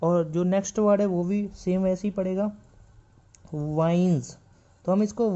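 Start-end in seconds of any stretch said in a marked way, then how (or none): 3.5-4.4 वाइन्स with a final z; z sound at end. Shown as a demonstration. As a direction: end वाइन्स on a buzzing z, wainz. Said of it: "इसको पढ़ेंगे जेड के साथ".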